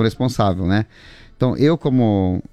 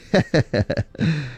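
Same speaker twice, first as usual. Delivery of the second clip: short amused laugh; light run of chuckles; 2 semitones higher than usual. Real, not acted. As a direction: chuckle after teasing a friend. Teases Sérgio about last year.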